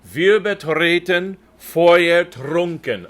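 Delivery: slow, loud, every R rolled in Wir betreten Feuer trunken; rolled R's. Shown as a demonstration. Director demonstrating rolled R's to the tenors. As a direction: roll each R hard and slow. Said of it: "you can hear my good rolls R's".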